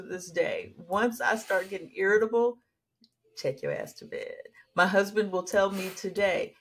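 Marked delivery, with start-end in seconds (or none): 3.4-4.3 low, hushed mother's command; low muttered order. Retold or imitated. Mother telling cranky child, hushed low order.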